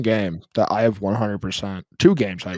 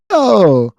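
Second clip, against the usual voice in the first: Kirby voice; 9 semitones higher, 7 dB louder than usual. Retold or imitated. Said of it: "He goes".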